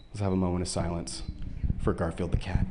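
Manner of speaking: low, hushed mock-solemn tribute; hushed mock eulogy for old cartoons. Mock moment of silence.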